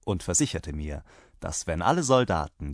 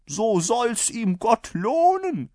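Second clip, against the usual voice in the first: high, loud, drawn-out thanks; hearty rider; 13 semitones higher, 6 dB louder, slower than usual. A narrator's reading. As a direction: bless the host slowly and boldly. (laut gedehnter Dank des Reiters)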